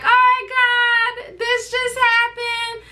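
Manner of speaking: high, slow shocked wail; retold panic. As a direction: replay the moment of shock, drawn out high. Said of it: "and I'm like".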